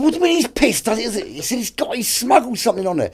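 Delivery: strained, very high retold exchange; mock alarm. Boss and skipper on sat phone about smuggled rum, high mock alarm. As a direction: send the voice right up in mock disbelief.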